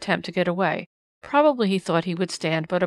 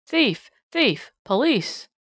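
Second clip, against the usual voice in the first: high, loud, desperate cries of thief; frantic shrieks; 5 semitones higher, 3 dB louder, much slower than usual. A narrator's reading. Man in nightshirt grappling a tramp, frantic high shrieks. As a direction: scream for the police in a panic. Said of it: "frantically shrieking".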